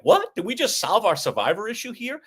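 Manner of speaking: 惊讶地提高嗓门; 突然高声惊呼; surprised what. Retold or imitated. Surprised on-air realisation.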